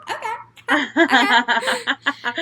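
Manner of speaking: high squealing laugh through the words; flustered, delighted, laughing acceptance; giddy giggling reaction. Retold or imitated. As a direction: giggle helplessly while agreeing. Retold giddy reaction to his yes.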